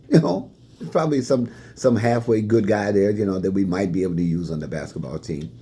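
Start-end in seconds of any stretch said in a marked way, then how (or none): none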